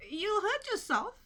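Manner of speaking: high, loud fussing hen; worried hen. A narrator's reading. Hen warning Little Pig, high and fussing. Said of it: "said the hen".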